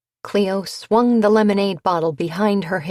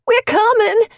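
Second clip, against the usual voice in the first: high loud shout; yelled; 8 dB louder than usual. A narrator's reading. Henry shouting to Gabby, loud high yell. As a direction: shout to reach her through the brush. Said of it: "Henry yelled".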